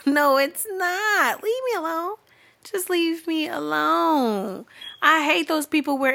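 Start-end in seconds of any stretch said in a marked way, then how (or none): 0.1-4.7 high, drawn-out mock-crying plea; comic high-pitched whine; exasperated whine. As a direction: wail it slowly, wanting to be left alone. Acted exasperated reply to DM sender.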